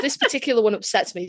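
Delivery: usual speaking voice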